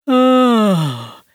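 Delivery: smooth, oily drawn-out voice; slick, unctuous exclamation; oily villain. A narrator's reading. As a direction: ooze it out like spreading oil. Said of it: "voice that reminded me of light crude oil spreading".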